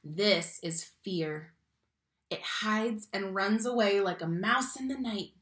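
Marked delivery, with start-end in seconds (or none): none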